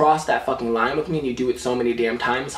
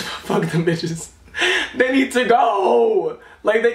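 slow, drawn-out laughing rant; 9 semitones higher, slower than usual. Real, not acted. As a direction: stretch it out through laughter. Ranting at ants, laughing.

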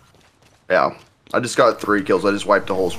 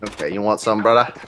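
usual speaking voice

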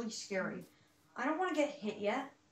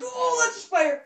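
high loud startled cry; frightened yell; 10 semitones higher, 13 dB louder than usual. Real, not acted. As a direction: yelp as the spider appears. Spider suddenly spawns.

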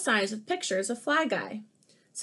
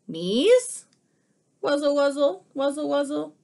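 buzzy fly voice; 5 semitones higher, 7 dB louder than usual. Acted. Fly's buzzy speech.

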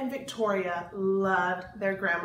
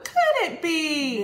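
puppet voice; 5 semitones higher, 7 dB louder, slower than usual. Pretend. Puppet answers in rhyme.

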